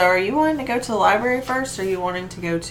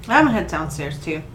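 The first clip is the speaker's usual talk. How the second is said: ordinary talk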